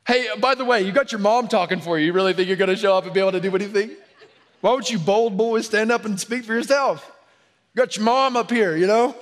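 high, loud, fast mocking taunts; hammed-up sneering put-down; sarcastic taunting. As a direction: ridicule the boys with a laugh. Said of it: "with a laugh, sarcastic".

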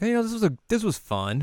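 pleased about the day; content Yohane. Retold or imitated. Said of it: "she's like".

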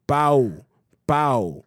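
"Pau" said to rhyme with "cow"; Spanish "Pau" ending in an "ow" sound; Spanish pronunciation. Shown as a demonstration. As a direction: demonstrate "Pau" as "pow", no L. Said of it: "How do you say P A U in Spanish".

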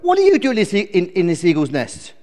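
high, loud chirpy bird voice; eager little sparrow; cheerful sparrow. Acted. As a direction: chirp a perky introduction. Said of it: "he chirped cheerfully".

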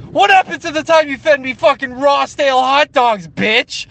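high, loud indignant yell; mock furious accusation. [name] confronting the now-pious family, mock furious yelling. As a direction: shout it like a hammed-up reckoning.

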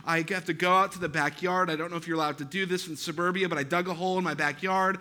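ordinary talk

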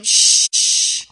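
two forceful shushes; loud shushing. Acted. Crowd silencing the blind beggar, loud shushing. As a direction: hush someone sharply, twice. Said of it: "the crowd start telling him".

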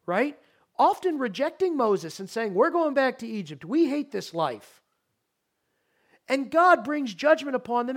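loud, high, driving sermon voice; full-force preaching. Acted. Pastor to congregation on Israel, preaching at full force. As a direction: preach it out to the whole sanctuary.

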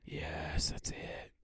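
breathy mock-relieved whisper. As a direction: whisper it like a prayer answered. Mock relief at Madea ending.

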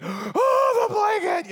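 high, loud melodramatic wail; dramatic child. Retold or imitated. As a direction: play the kid's tragedy over a blanket. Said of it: "brought on World War Three".

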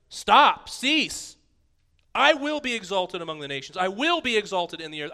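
God exalted among the nations, loud; commanding shout. Acted. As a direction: proclaim it over the nations. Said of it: "a phrase to the nations".

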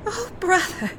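put-on a little girl crying out in fear; high, fearful little sister; frightened girl. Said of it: "said Natsu".

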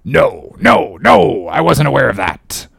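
an odd stranger's mild wonderment; wondering. Acted. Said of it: "said Elijah slowly and wonderingly".

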